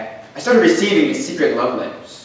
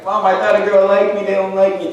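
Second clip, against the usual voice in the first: mock wail to mom, pitched up; high, plaintive kid lament; bawling; 5 semitones higher than usual. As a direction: re-enact a heartbroken kid complaining, no real tears. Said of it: "I was bawling".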